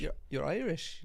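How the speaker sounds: slow, tentative Irish accent; Irish accent attempt on request. Shown as a demonstration. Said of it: "so you can do Irish".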